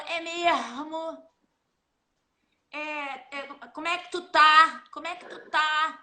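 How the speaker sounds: a hint of Carioca, É mesmo slow and drawn; lightly mimicking a Carioca greeting; Carioca speech. Teacher imitates how Cariocas greet, lightly accented. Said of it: "no Carioca fala".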